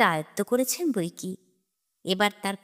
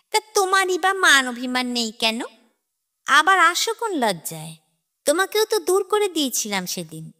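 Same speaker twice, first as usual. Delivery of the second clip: sneering mockery; 7 semitones higher than usual. A narrator's reading. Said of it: "মুখ ভেঙে বলে ওঠেন".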